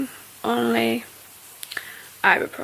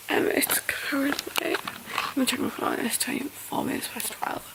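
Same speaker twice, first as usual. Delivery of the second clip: whispering; 9 dB quieter than usual. Real, not acted.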